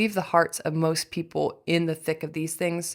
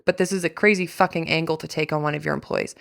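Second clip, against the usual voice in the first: ordinary talk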